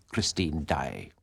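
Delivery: low, slow, contemptuous naming; a hint of scorn in the name; flung insult. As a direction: spit her name like an insult. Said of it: "as though he were flinging an insult".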